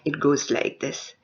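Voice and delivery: normal talking voice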